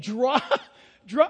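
real laugh breaking into the word. Real, not acted. Preacher cracking up mid-story, laughing. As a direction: trip on the word as laughter takes over. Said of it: "I'm sorry, I'm laughing".

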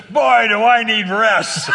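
loud, high, drawn-out yell; imitated yelling. Cab driver answering the offer of rest, yelling intensely. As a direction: boom it out slowly like the yelling driver. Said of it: "he yells ... it's the same intensity of his yelling".

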